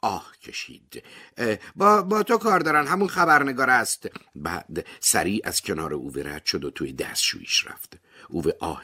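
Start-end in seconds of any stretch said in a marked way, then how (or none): 1.4-4.1 high, loud, sighing neighbor voice; breathless pregnant neighbor. A narrator's reading. Pregnant neighbor hands Ove the phone, high and winded. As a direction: Parvaneh puffs out the news, high and loud.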